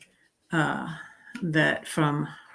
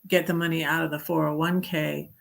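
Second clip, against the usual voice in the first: ordinary delivery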